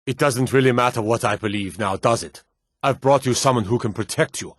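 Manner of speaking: ordinary talk, nothing marked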